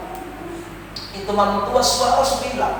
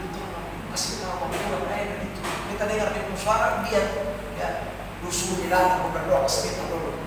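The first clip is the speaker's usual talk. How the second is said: hushed, much softer retelling of a sick woman's whisper; retold whisper; 2 semitones lower, 11 dB quieter, faster than usual. Sick woman whispering to her guard to fetch the pastor, hushed retold whisper. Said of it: "dia bisik kepada yang jaga".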